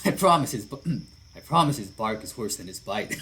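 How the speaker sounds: easygoing big lug giving an order; a hint of a burly biker calling to his buddy; big friendly biker. [name] reassuring.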